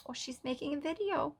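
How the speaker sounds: put-on high, soft Romanian accent; a cute little Romanian accent, sweetly; Mata's Romanian accent. Daughter-in-law explaining to her husband, in a soft, high Romanian accent. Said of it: "In her cute little Romanian accent".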